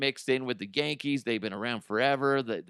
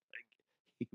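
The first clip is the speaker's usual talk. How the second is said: hushed, shocked whisper; 15 dB quieter than usual. Retold or imitated. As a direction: whisper it in disbelief. Reacting to news story.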